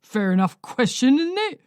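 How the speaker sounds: husband glaring at the visitor, pressing; menacing old man. A narrator's reading. Old man continuing hostile.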